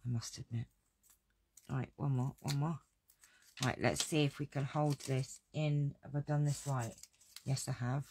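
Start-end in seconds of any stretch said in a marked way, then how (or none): none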